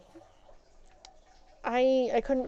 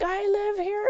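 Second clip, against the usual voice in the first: mocking the thief; 10 semitones higher than usual. Thief voiced.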